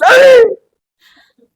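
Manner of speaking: sharp high loud yelp; mock demon shriek. Preacher mimicking a demon, sudden high shriek.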